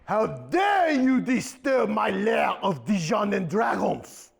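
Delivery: slow, commanding sorceress voice; menacing diva; booming villain diva. Acted. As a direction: declare it like a wicked diva in her lair. Villain Celine Dijon confronts intruder.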